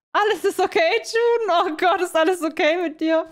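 high, loud panicked questioning; hammed-up worried shouting; acted alarm. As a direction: cry out as if a friend is collapsing. Alarmed character checks on dizzy June.

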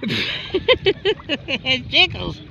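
slow giggly words; being tickled. Real, not acted. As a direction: laugh it out while tickled. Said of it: "Don't tickle me".

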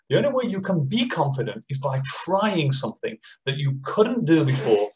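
ordinary delivery

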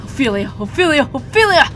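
shouting a name in anguish; desperate shout. Acted. Villain calling out in game.

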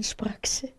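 ordinary delivery, nothing marked

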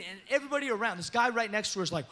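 ordinary delivery

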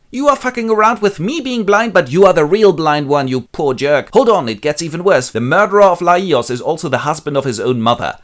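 high, loud indignant rant; angry seer. Acted. Tiresias scolding Oedipus, high and loud. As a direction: tell the king off. Seer rants back at king.

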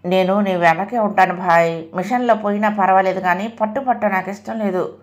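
ordinary delivery, nothing marked

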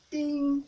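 loud chiming ding; loud bell ding. Shown as a demonstration. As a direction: ring the bell hard. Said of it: "by saying ding".

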